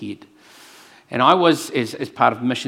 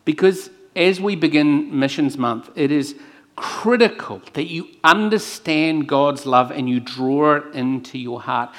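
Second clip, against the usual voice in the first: ordinary talk, nothing marked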